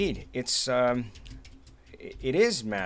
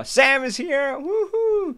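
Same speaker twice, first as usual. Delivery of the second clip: much higher, cheering; excited cheer; 11 semitones higher, 4 dB louder, much slower than usual. Real, not acted. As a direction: cheer a friend's arrival. Greets hackathon champion on stream.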